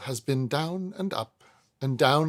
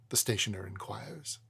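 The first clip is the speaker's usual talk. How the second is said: plain reading voice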